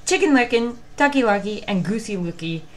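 usual speaking voice

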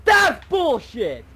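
furious high shout; raging gamer. Real, not acted. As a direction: yell at the game in outrage. Player fails jump, rages.

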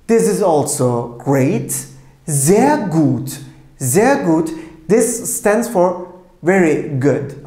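ordinary talk, nothing marked

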